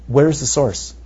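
normal talking voice

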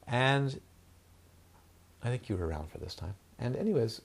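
usual speaking voice

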